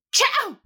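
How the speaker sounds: huge high, loud sneeze. Acted. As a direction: let a big sneeze burst out. Sneeze in the middle of talk.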